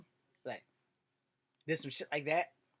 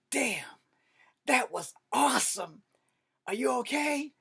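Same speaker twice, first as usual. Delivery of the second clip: high, loud amazed exclaim; excited friend; 8 semitones higher, 7 dB louder, slower than usual. Retold or imitated. Friend watching the narrator fall down the hill, amazed. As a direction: wow at the fall, then ask. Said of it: "Lloyd said".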